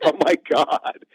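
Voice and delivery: slow, high mortified groan. Retold or imitated. Caught blurting to a stranger, mortified. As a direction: drag it out in embarrassment. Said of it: "You're just like".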